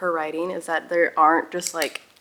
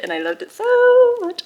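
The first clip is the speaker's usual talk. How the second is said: much higher and louder, gushing; 8 dB louder than usual. Real, not acted. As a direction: burst out with delight. Gushing about finished book.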